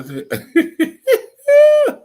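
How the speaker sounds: high squealing laugh through the words; real burst of laughter; laughing hard. Real, not acted. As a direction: crack up mid-sentence.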